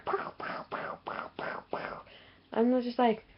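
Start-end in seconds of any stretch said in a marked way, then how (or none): 0.1-2.0 soft, breath-only pow sounds; whispered gunshots. Retold or imitated. Imitating the chopped-off hand's gun, whispered shots. Imitating gunfire from film scene.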